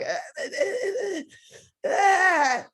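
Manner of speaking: high hammed-up fake sobs with a laugh; mock crying sounds for the director; mock sobbing. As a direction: pretend to break down crying, half laughing. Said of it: "And I'm like".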